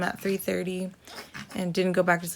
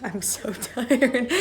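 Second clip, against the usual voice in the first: sigh, then slow, laughing through "tired"; laughing tiredly; 4 semitones higher, 5 dB louder, slower than usual. Real, not acted. Worn-out mom telling viewers, tired laugh. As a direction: laugh at your own exhaustion.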